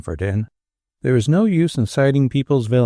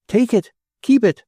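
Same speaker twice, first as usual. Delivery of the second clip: urging stranger; 11 semitones higher than usual. A narrator's reading. Stranger urging money on Tenburum.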